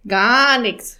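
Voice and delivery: rasping raven. Acted. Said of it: "schnarrte Jakob".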